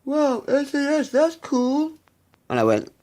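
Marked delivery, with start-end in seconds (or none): 0.0-1.9 high, loud, slow nerdy mock voice; mocking the nerd. Mocking the nerd's reply to his threats, high loud voice. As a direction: ham up a dopey nerd's reply. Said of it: "And he goes".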